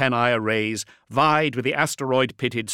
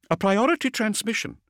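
brisk, higher female officer; vox officer voice; 13 semitones higher than usual. A narrator's reading. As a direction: report it like a busy comms officer.

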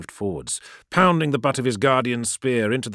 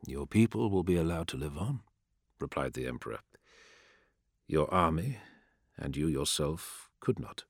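lower, softer, measured emperor; a lightly voiced cold emperor, lower and softer; calm emperor; 4 semitones lower, 7 dB quieter than usual. A narrator's reading. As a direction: speak as an unmoved ruler passing sentence.

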